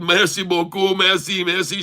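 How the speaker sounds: high, gushing retold thanks; effusive thanks. Traveler thanking the woman who helped, effusive. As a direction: pour out repeated thanks, pitched well up.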